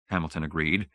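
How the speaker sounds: plain reading voice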